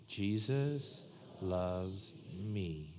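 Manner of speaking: "Jesus loves me" stretched out very slowly and softly; very slow, soft recital. Shown as a demonstration. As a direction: draw each word out gently for the room to follow. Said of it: "say it with me".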